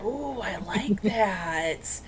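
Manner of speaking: whisper. Real, not acted. Quiet delighted aside.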